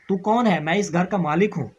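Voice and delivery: loud, stern challenge to a stranger; angry demand. Acted. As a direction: bark it out as the master of the house. Angry father confronts the girl.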